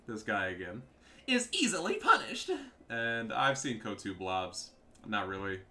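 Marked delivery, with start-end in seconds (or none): 1.3-2.5 high, loud mocking voice; mock whiny opponent. Player finishing rival's blobbing complaint, high mock whine. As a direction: parrot it in a high pitch.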